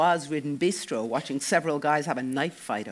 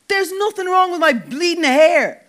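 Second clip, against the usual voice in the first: much higher, much louder, furious; outraged shout; 12 dB louder than usual. A narrator's reading. Dec outraged at his teasing friends, shouting. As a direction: yell it, fully outraged. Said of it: "outraged Dec even more".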